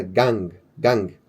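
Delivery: gang spoken low with a nasal ng ending; Korean syllable demo. Shown as a demonstration. Teacher modelling gang for students. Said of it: "a gente já viu que".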